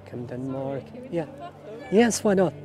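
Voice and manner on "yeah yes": ordinary talk, nothing marked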